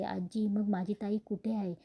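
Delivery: ordinary delivery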